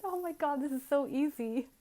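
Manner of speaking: high, loud delighted exclaiming; retold joyful disbelief; thrilled. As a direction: burst out in happy amazement. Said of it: "I went".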